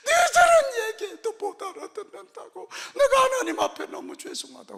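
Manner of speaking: put-on high, loud tearful old mother; weeping old mother. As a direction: cry out to her son in tears. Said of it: "눈물을 뚝뚝 흘려가면서". (눈물을 뚝뚝 흘리며 아들에게 높은 목소리로 울며 호소하는 어머니)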